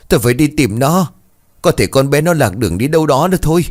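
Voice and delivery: desperate to run out and search; mother frantic over missing daughter. A narrator's reading.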